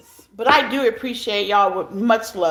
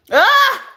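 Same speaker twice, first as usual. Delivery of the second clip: sudden high, louder cry of emotion; tearful high cry; 13 semitones higher, 5 dB louder than usual. Real, not acted. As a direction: let out a teary wail. Overcome by tears on live.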